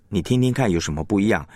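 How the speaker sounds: normal talking voice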